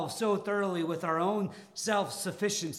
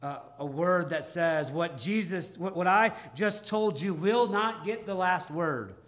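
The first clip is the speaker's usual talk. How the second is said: normal talking voice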